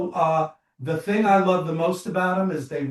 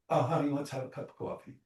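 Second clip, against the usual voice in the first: much softer, quick coaxing offer; soft honey; 10 dB quieter, faster than usual. Retold or imitated. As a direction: murmur it gently to your spouse. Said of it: "Another couple".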